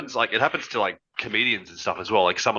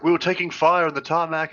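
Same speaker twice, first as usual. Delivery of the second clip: lightly voiced higher mock Clinton; a hint of Clinton, pitched up; mocking Hillary Clinton; 7 semitones higher than usual. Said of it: "Hillary Clinton's like".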